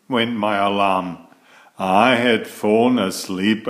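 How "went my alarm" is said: plain reading voice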